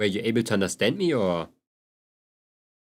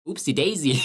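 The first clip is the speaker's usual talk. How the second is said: a hint of a higher, hyped streamer yell; Paluten voice; 8 semitones higher, slower than usual. Imitating a German YouTuber for chat, a light hyped cheer. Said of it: "this Paluten voice".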